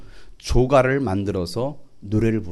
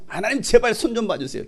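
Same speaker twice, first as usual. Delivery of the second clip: higher, pleading voice; mock prayer; 8 semitones higher than usual. Preacher imitating a vengeful prayer, higher mock pleading. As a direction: plead to God like a petty believer. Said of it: "기도는 하지 마세요".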